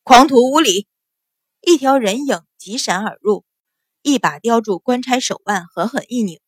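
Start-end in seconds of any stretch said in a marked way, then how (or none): 0.0-0.9 loud, forceful rebuke; sudden thundering shout; explosive shout. A narrator's reading. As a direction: roar at the offender from outside. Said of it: "一声暴喝".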